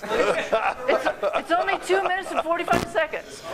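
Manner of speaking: playful protest. Real, not acted. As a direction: stretch it out, teasing the timekeeper.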